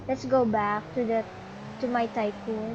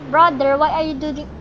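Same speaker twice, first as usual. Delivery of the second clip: loud scolding call; 2 semitones higher, 9 dB louder, faster than usual. Real, not acted. Scolding brother loudly.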